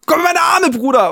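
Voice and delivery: high, loud call to a brother; shouted welcome. Retold or imitated. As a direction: yell it with arms wide open. Retold greeting, shouted embrace call.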